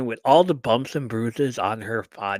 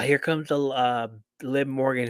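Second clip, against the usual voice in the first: ordinary talk, nothing marked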